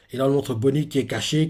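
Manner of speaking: ordinary delivery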